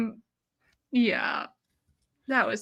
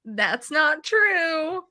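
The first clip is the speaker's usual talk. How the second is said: mock Leah denial; 6 semitones higher, much slower than usual. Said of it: "Leah's like".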